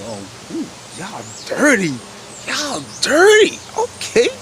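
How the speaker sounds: rising, stretched "y'all dirty" in mock shock; playful gasp of mock outrage; mock scandalized. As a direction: tease them like a scandalized friend. Mock scandalized reaction to scheme.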